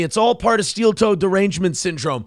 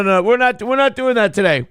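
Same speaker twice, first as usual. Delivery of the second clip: ordinary talk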